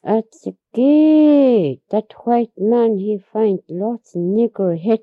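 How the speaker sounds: high, loud, drawn-out alarmed cry; old woman crying out in alarm; frightened cry. Retold or imitated. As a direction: cry out in fright, stretching the first word. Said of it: "He say".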